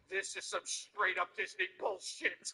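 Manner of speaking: put-on deadpan kid verdict. Furious toddler telling dad off, slow deadpan. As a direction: lay it down flat and heavy like a fed-up kid.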